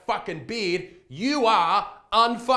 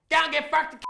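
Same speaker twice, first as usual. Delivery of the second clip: higher, punchy Aussie telling-off; Aussie retort; 9 semitones higher, 3 dB louder than usual. Retold or imitated. Vlogger tells the English what Aussies say, in a punchy retort. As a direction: fire it back like a proud Aussie. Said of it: "I'll tell you what we say here".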